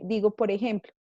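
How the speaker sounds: ordinary talk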